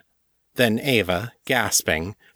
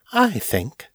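high, poised mistress; 7 semitones higher than usual. A narrator's reading. Mistress advising Morgan to rest, high and poised. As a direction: pitch it high, with authority. Narrator voices Ava, the mistress.

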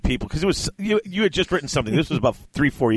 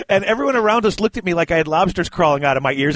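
normal talking voice

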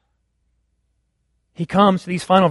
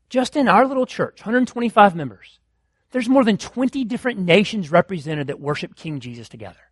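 ordinary delivery

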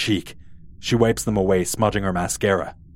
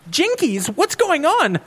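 much higher, panicked Velma; alarmed cry; slower than usual. Acted. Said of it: "cries Velma".

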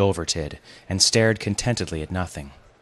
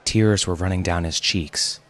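ordinary delivery

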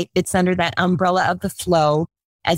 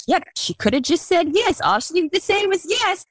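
much higher, lightly voiced child teasing; kids teasing mom; 10 semitones higher than usual. Sons tag-teaming to call mom out, higher kid voice. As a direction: play the kids ganging up on mom. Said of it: "they're like".

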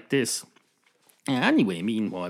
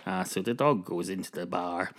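ordinary delivery

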